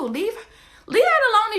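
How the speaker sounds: bossy mock telling-off; scolding. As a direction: snap him away from there. Scolding him mid-act.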